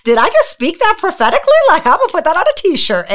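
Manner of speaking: high-pitched, playful self-amazement; amazed at own words. Real, not acted. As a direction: marvel at your own line in a high voice. Jokingly amazed at own line.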